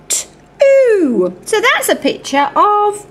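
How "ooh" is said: ordinary delivery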